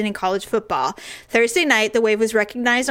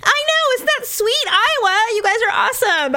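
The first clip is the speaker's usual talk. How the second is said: gushing excitement; 12 semitones higher than usual. Real, not acted. Gushing to Iowa listeners.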